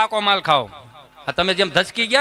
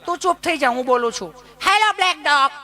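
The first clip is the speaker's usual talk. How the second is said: high, prim bossy voice; know-it-all educated lady; 6 semitones higher than usual. Retold or imitated. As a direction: hush him, then coo at the dog. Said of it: "એનથી ઊંચું ભણેલા છે". (વધુ ભણેલી બેન અકડાઈથી બોલે)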